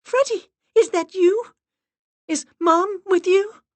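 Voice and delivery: high, loud, eager cry; excited call. Acted. Miranda calls to the dog for her mom, loud. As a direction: call out desperately hoping.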